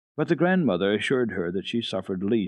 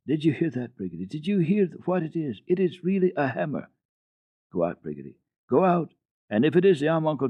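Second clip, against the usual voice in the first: put-on high, eager old woman's voice; a hint of an excited grandmother; lightly voiced old grandmother; 7 semitones higher than usual. A narrator's reading. Grandmother speaks.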